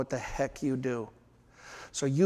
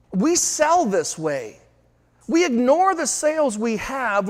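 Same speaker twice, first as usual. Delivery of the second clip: higher and louder, driving the point home; rising urgent call; 8 semitones higher, 4 dB louder than usual. Real, not acted. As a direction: shout the order to slam the brakes. Urging salespeople to change course.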